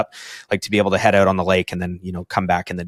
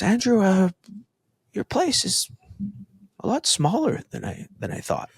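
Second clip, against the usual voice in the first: imitating a French accent, much higher voice; mock French guest, pitched way up; 12 semitones higher, 4 dB quieter than usual. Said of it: "he had a French accent".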